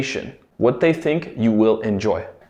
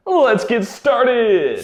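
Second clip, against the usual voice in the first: hyped kickoff; 8 semitones higher, much slower than usual. Acted. Host kicks off the lesson.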